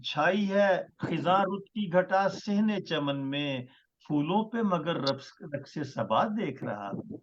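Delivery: measured, stately poetry recital; ghazal recitation. Acted. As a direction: recite each line with weight. Poet recites his ghazal.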